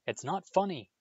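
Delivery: fierce, high-pitched protest. A narrator's reading. Ron to giggling Hermione, fiercely. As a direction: snap back indignantly, pitch jumping up. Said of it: "said Ron fiercely".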